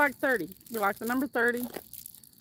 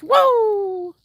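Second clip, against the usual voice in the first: excited woo; 8 semitones higher, 9 dB louder than usual. Real, not acted. Cheering a chat donation.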